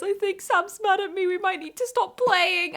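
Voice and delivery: lightly acted anxious little voice; retold aside to friends. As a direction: pipe it up, sheepish warning to friends.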